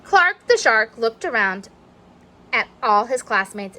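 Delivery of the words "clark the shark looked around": plain reading voice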